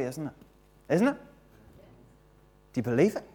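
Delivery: ordinary talk, nothing marked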